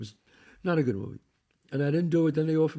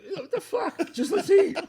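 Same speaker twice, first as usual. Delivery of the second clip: laughing exasperation. Retold or imitated. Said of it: "I'm like".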